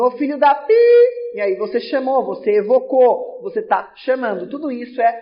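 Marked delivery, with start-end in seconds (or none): 0.0-0.7 higher, louder half-censored call-out; mock exclamation. Teacher joking an insulting call-out to the class, mock shout. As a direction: playfully shout at someone with the insult bleeped. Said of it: "precisa daquele pizinho".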